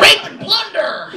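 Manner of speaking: slow, high pirate boast; mock pirate. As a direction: ham it up like a pirate. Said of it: "married to a pirate".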